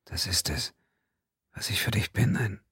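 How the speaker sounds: husky, hushed whisper; creaky whisper. Acted. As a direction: breathe it hoarsely, close to her lips. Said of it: "Sandessios Stimme war nur ein heiseres Flüstern".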